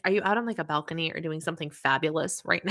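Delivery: ordinary talk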